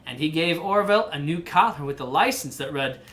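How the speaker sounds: lively picture-book narration; reading to a child. A narrator's reading. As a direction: read the story aloud to your kid.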